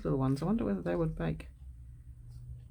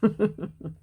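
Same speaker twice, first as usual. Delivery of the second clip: laughing; 4 semitones higher, 6 dB louder than usual. Real, not acted. Laughs at her own demo.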